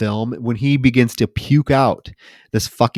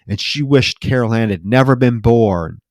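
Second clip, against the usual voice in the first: ordinary talk, nothing marked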